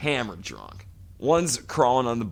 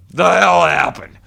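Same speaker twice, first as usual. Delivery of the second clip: crying outburst; 7 semitones higher, 12 dB louder than usual. Retold or imitated. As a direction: howl it like a man cracking. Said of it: "Not even what the hell happened, but".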